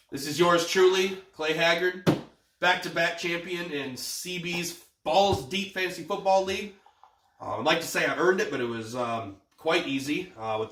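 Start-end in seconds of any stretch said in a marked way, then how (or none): 0.1-6.7 announcer voice. Real, not acted. Gloating champion self-introduction.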